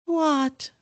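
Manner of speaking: high sulky what; pouting ghost. Acted. Myrtle answering sulkily. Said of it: "she said sulkily".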